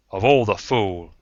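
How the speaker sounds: loud exasperated snap; angry outburst. Acted. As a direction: blurt it out in disgust. Anderton bursts out angrily.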